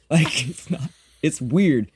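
ordinary talk, nothing marked

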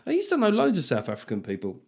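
ordinary talk

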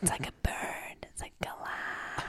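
soft whispered explanation; giggly prank whisper. Pretend. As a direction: whisper it so only one hears. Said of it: "I didn't catch that either".

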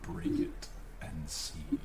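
low whispering spirit voice; eerie whisper. Acted.

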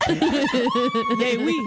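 squealing with laughter; laughing shout. Real, not acted. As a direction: shriek it while cracking up.

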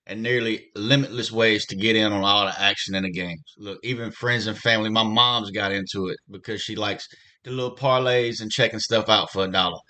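upbeat sponsor read. Acted. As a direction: sell it like a radio spot. Host reads sportsbook ad.

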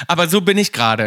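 ordinary talk

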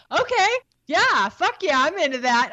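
high, bright mock enthusiasm; fake eager agreement. Herself faking eagerness to please her hot boyfriend. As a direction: oversell it like you're totally in. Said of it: "I was like".

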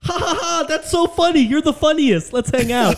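put-on high, loud, laughing girly voice; mock girly laugh. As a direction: play the giggly Tinder match. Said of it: "they all respond like".

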